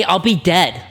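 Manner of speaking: normal talking voice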